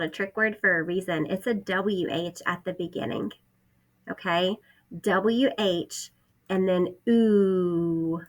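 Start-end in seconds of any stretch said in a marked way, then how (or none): 5.0-8.2 letters drawn out slowly; slow sounding out. Shown as a demonstration. Teacher sounding out who for first graders. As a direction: stretch each sound out. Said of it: "teacher sounding out letters".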